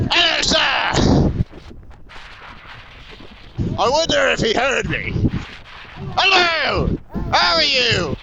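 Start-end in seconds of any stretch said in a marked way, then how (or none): 0.1-1.3 drawn-out, high called-out greeting; yelling at a passerby. Real, not acted. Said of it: "I can yell at people". 6.1-8.1 high, hearty call to a stranger; pitched-up shouted hello; yelling at people. Real, not acted. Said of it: "It's weird that I can yell at people".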